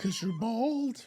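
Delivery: put-on high, slow kid's blurt; little girl. Little girl to her bald coach, high drawn-out child voice. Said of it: "and then she goes".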